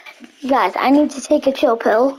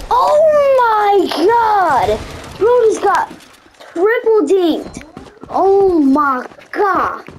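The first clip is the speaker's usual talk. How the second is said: excited shout; 8 semitones higher, slower than usual. Real, not acted. Streamer yelling to viewers about a triple dink, excited shout. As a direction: yell it out in real disbelief and glee. Teammate gets triple dinked.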